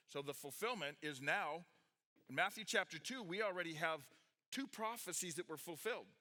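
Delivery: ordinary delivery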